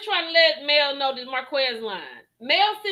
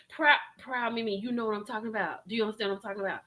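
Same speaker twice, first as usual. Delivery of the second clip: soft, lower aside to a friend; hushed aside; 5 semitones lower, 8 dB quieter, much faster than usual. Real, not acted. Reactor confides to a friend, soft and hushed. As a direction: lean in and murmur it.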